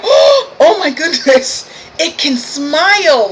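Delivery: slow, high-pitched mock astonishment; hammed-up, drawn-out disbelief; mock amazement. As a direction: gasp it out as a shocked coworker. Mimics coworkers amazed at her smile.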